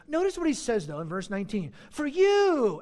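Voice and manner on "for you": loud, high mock admiration; sarcastic. Preacher reads Paul's jab at the Corinthians, sarcastic. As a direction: exclaim it with heavy sarcasm. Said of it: "and here's the sarcasm".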